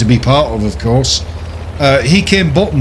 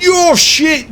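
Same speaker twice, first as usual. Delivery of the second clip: mock insult; 13 semitones higher than usual. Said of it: "and said".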